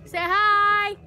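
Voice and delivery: loud, high, drawn-out call; high holler with a long last word. Real, not acted. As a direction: shout it out cheerfully to someone nearby. Calling out to someone on camera.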